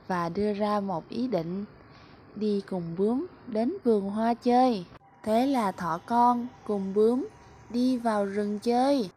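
usual speaking voice

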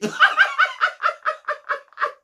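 a squealing burst of laughter; high shrieking laugh. Real, not acted. Laughing at the PDA clip.

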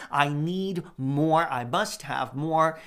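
greedy hunger, spoken slowly; greedy craving. Acted.

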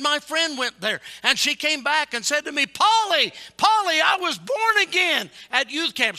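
high, loud cry of joyful news; emotional retelling, shouted. Retold or imitated. Friend back from camp telling Polly she was born again, emotional shout. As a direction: build to a shouted testimony. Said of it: "she got really emotional".

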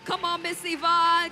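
ordinary delivery

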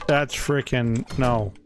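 ordinary delivery

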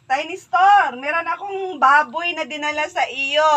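loud call across the yard. Real, not acted. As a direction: shout to someone out of sight. Calling out to store owner.

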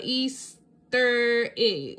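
Easter egg stretched very slowly, syllable by syllable; sounding out. A narrator's reading. Bluey reading the chatterbox, slowly sounding out each syllable. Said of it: "Bluey sounds out".

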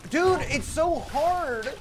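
slow, high whining complaint; whiny. Real, not acted. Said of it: "I'm such a little whiny fuck".

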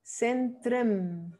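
centrem with weak "trem" ending; correct Dutch version. Shown as a demonstration. Teacher modelling "centrem", reduced Dutch ending. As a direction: say "centrem" with a short E-like last vowel.

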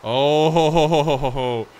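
loud gloating ho-ho laugh; gleeful loud chuckle. Real, not acted. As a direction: cackle while raiding a friend's stash. Looting Nico's house, laughing.

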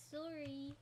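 "Sorry" said softly as SORE-ee; soft mimicry of Canadian "Sorry", round o. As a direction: demonstrate "Sorry" with a long o. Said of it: "You say like that".

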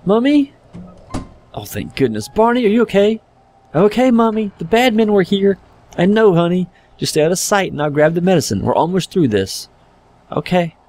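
put-on high childlike voice throughout; child's voice. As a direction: play the small frightened boy and his mum.

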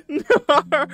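squealing through a high laugh. Real, not acted. Streamer cracking up at a gross chat suggestion, laughing.

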